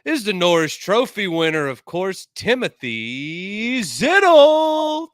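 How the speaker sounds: loud, high announcer welcome, name drawn out slowly at the end; hyped show intro. Acted. Host welcoming viewers back to Center Ice, booming announcer voice. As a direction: open the show like a hockey arena announcer.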